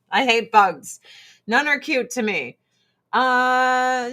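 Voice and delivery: usual speaking voice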